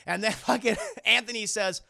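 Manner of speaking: normal talking voice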